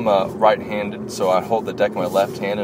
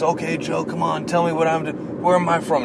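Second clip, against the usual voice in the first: higher-pitched imitation of an eager challenger; eager player; 9 semitones higher than usual. Player challenging Joe to place his accent, eager higher voice. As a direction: put on a higher voice, egging someone on.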